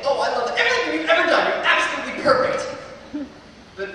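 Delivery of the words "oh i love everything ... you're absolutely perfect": higher, louder, fast, overly sweet gushing; mock gushing praise. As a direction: gush sarcastically, faking total adoration. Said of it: "love isn't about saying".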